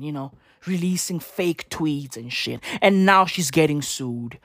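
ordinary talk, nothing marked

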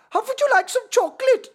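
very high, loud mock Swiss; Swiss accent. Friend making others guess the country, high mock Swiss. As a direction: squeak out a badly faked Swiss accent. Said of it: "That's Swiss".

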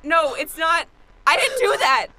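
loud, high frantic pleading; panicked denial. Acted. Denying the murder to the witness in loud panic. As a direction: blurt it out, desperate to be believed.

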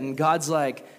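usual speaking voice

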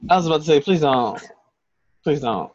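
usual speaking voice

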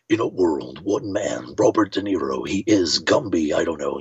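low, dramatic movie-trailer announcer. Shown as a demonstration. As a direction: drop way down and intone like a film trailer. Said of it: "that movie trailer voice".

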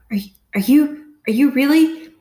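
high, loud, choking question; barely believing his eyes; father seeing his missing daughter; stunned disbelief. A narrator's reading. Dad choked with emotion.

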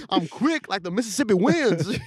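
high, drawn-out mock Southern voice; caricatured old-time impression; Harriet Tubman. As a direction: stretch it out like a hammed-up folk saying. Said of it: "That's exactly how she talked".

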